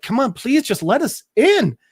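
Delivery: high, imitated begging; mock-pleading whine of a request. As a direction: plead to be let in, voice lifted. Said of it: "they're pleading with him".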